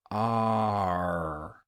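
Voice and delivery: R held long and open; R with the mouth open, nothing in the way; open English R. Shown as a demonstration. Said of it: "when you make an R sound".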